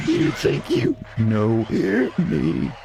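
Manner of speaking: slow, high, eerie monster voice; creepy monster voice. Shown as a demonstration. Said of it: "shift it up from that creepy voice".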